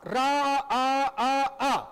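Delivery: रा, आ, आ, आ stretched long, the vowel held three times over; teacher holding the आ of राम to show pluta; drawn-out raa. Shown as a demonstration. Teacher showing students the stretched आ of राम. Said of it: "तीन गुना बढ़ जाता है".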